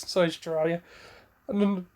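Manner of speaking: ordinary delivery, nothing marked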